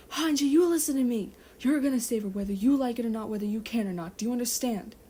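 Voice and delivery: loud, forceful desperate order; fierce command. Acted. Levi ordering Hanji to save Listener, fierce and loud. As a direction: demand it with everything you have.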